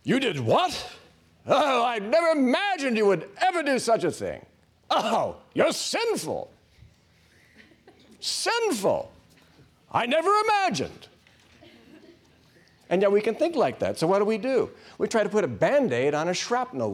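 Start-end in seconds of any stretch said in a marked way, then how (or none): 0.0-11.1 much higher, shocked, prissy scolding voice; mocking scandalized God. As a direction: ham up a horrified, prudish judge. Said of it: "It's just like God is like this".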